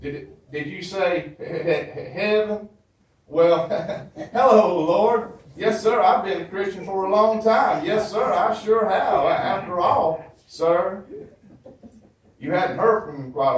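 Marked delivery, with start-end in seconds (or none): none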